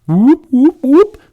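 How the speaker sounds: very high, loud alarm whoops; siren whoop. Pretend. Mock siren before bio.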